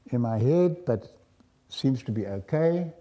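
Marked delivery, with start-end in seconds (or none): none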